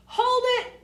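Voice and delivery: high, loud shout to halt; yell. A narrator's reading. Artie yells from above to stop the play, high loud shout. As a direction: stop them all with one shout. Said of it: "Artie yells from above".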